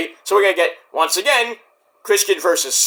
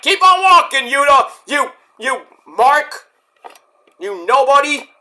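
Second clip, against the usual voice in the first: higher, raised-voice taunt; scornful berating; 7 semitones higher, 4 dB louder than usual. Retold or imitated. Moxley chasing off Yuta, mock contemptuous yelling. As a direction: jeer at him, starting loud and high.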